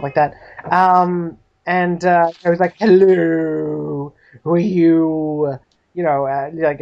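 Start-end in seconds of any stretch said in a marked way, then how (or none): 2.8-5.6 slow, drawn-out butler voice; stuffy butler. Acted. Butler greets a stranger at the door, stuffy. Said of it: "stuffy, uh, mustached butler".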